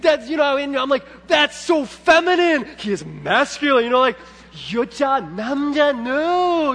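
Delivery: put-on imagined outburst against girly wings; protesting. Dad objecting to the instructor, hammed-up loud protest. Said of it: "I wanna say".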